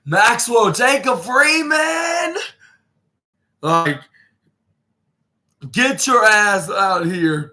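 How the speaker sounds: high, slow, drawn-out imitated callout yell; mock callout shout. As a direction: stretch each word like a wrestler calling out his rival. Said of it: "Rock Hard Juice Robinson saying".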